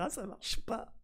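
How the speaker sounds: high, whiny mock complaint; mock disappointed viewer. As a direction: whine it like a fooled fan. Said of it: "Ils vont dire".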